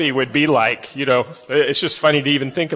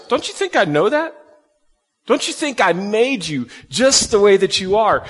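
higher, emphatic retort as God; a touch of an exasperated God; God answering; 4 semitones higher than usual. Acted. God retorting to Moses's excuses, lightly exasperated.

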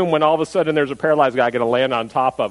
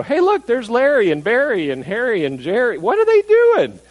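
higher-pitched mock astonishment; surprised onlookers; 8 semitones higher, 3 dB louder than usual. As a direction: call out like a puzzled bystander spotting friends. Onlookers acted out spotting friends above.

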